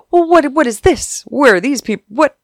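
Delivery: high startled questioning; confused guard. Retold or imitated. Guard baffled at extra people coming in, startled.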